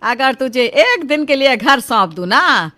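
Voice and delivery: तिलमिलाकर ऊँची आवाज़ में डाँटना; बेटे को गुस्से से तिलमिलाकर फटकारो. Acted. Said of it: "गुस्से से तिलमिला उठती है". (बेटे पर भड़कती माँ, ज़ोर से डाँटती हुई)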